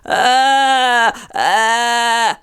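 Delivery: loose creaky vocal fry. Shown as a demonstration. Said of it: "like this".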